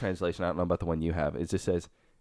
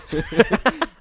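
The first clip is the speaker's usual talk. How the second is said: high laugh; 5 dB louder than usual. Real, not acted. Laughing at the joke.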